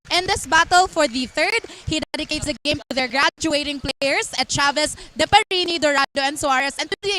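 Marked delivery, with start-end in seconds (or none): none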